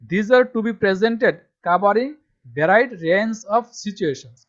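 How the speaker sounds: reads the English line out very slowly and loudly, word by word; slow dictation reading. Shown as a demonstration. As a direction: spell it out slowly for students to copy. Reading syllabus text aloud. (ধীরে ধীরে জোরে পড়ে শোনানো)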